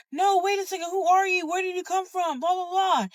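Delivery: high-pitched mock pleading questions; imitated worried crowd. Churchgoers trying to stop her leaving, high mimicked pleading. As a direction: mimic people calling after someone, pitched well up.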